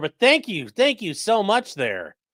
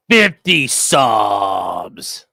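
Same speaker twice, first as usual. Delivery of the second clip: drawn-out announcement; 8 semitones lower, 7 dB louder, much slower than usual. Real, not acted. Repeating the donation.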